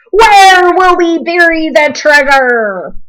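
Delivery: high, loud, slow lament; captain fretting over his gold; distressed pirate holler. A narrator's reading. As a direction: cry it out in despair.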